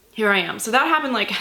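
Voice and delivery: ordinary talk, nothing marked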